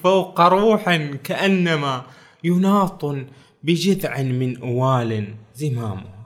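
slow, declaimed verse; slow classical Arabic verse recital; poem recitation. Retold or imitated. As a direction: recite it slowly like an old poem. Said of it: "قال".

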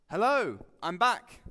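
bright loud hello as Jesus; a jaunty, loud "I'm back"; cheery greeting. Acted. Jesus breezily greeting his stunned disciples, loud and cheery.

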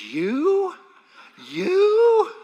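high, loud disbelieving "You?"; incredulous mother. Retold or imitated. Mother doubting her son could be a pastor, incredulous shout. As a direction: scoff in disbelief like mom. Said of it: "She goes".

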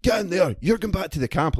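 mock officer command; mock barked order. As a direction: order him into the lorry, hammed. Quoting the officer ordering him.

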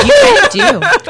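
high squeaky cartoon mouse; Mickey Mouse voice. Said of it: "You sound like Mickey Mouse".

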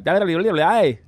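high, loud babble of nonsense syllables; mock the English office chatter as noise; mock foreign gibberish. Mocking how the English office talk would sound, loud babble. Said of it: "it would have come out like".

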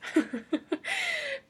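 high-pitched burst of laughter. Real, not acted. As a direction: let out a high squealing laugh. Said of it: "I'm laughing".

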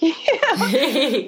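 laughing it off; a bright laugh over the words; amused laugh as she finishes. Real, not acted. Laughing after the mirror story.

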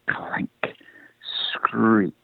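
hushed, drawn-out, suspenseful noise words; whispered sound words. A narrator's reading. Narrator imitating the rider's armour in the tunnel, ominous whisper. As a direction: whisper each sound separately, with a pause between.

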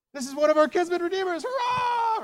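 high, loud squeal of joy; a thrilled old woman cheering at the top of her voice; all excited. Acted. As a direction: shriek the news like she can't contain it. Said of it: "She's all excited".

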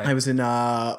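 ordinary delivery

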